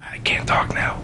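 slow voiceless whisper. Retold or imitated. Man on a dark bus whispering into his phone. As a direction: hush it into the phone. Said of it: "And he's whispering".

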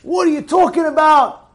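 high-pitched mock outburst; retold scream. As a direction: imitate an outraged objector crying out. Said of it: "He started to scream".

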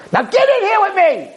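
very high acted shriek; menacing shriek. Woman grabbing the trapped man, shrieking her command.

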